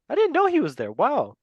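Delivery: sudden high squeak of surprise; surprised. Real, not acted. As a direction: caught off guard, pitch jumps up. Surprised at a player's club.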